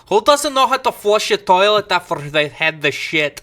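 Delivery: imitating a thick Scottish mother scolding; broad Scottish accent. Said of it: "she has this really thick Scottish accent".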